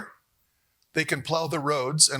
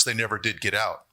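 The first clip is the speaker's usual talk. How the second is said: ordinary delivery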